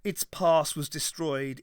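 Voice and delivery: plain reading voice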